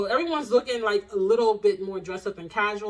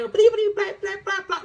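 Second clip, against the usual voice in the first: high, loud cartoonish swearing rant; mock cussing; 11 semitones higher, 6 dB louder than usual. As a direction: cuss like a sailor, bleeped. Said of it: "I cuss more than a sailor when I'm pissed".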